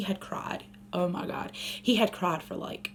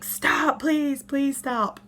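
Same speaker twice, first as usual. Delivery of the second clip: higher, slow, drawn-out pleading; 6 semitones higher, 4 dB louder, much slower than usual. Retold or imitated. Exhausted mom begging her crying son, slow pleading. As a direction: beg him to stop, worn out. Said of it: "I was just like".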